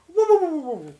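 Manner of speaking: high sing-song woo woo tones; imitating alien tones. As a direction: mimic the Close Encounters signal. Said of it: "drunken version of the intergalactic message".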